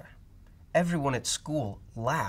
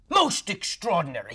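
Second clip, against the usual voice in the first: higher, louder astonished doctor; amazed exclamation; 6 semitones higher, 5 dB louder than usual. Acted. Doctor exclaiming over Camilla's stripes. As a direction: marvel at it. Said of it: "he exclaimed".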